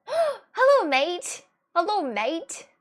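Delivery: high, loud put-on Australian greeting; exaggerated Australian hello; Australian accent. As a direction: greet a mate in playful mock Australian. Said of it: "I love Australian accent".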